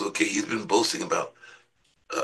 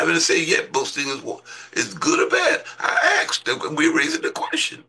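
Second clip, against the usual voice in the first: full-force preaching. Acted.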